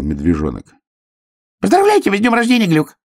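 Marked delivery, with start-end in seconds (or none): 1.6-3.0 high, rapid squeaky congratulation; радостный крик. A narrator's reading. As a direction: burst out fast and high. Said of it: "крикнул ёжик". (быстро, тонким радостным криком)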